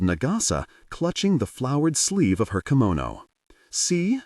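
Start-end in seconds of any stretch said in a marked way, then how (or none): 3.7-4.2 put-on small, high child asking; a two-year-old's tiny question; lightly voiced little boy; tiny toddler voice. A narrator's reading. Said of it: "he asked in his tiny voice".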